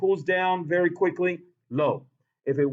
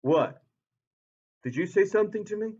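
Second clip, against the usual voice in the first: mild puzzled water voice; 4 semitones higher, faster than usual. Pretend. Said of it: "Water goes".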